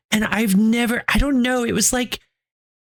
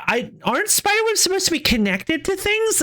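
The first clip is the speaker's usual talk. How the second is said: baffled question; 8 semitones higher than usual. Retold or imitated. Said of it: "was like".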